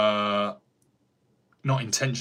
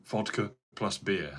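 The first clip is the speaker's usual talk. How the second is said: ordinary talk, nothing marked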